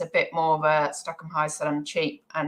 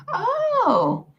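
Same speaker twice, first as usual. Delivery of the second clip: high, sing-song oh how interesting; mock interest; slower than usual. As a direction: gush with exaggerated interest. Reading a comment with mock interest.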